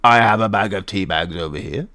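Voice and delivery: low put-on merchant voice; shopkeeper character. Shopkeeper points out tea bags and potatoes, low-voiced. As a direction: show off your wares in a deep character voice. Plays shopkeeper showing wares.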